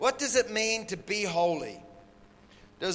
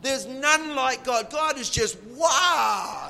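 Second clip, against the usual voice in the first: full-force preaching; 5 semitones higher, 5 dB louder, slower than usual. Acted.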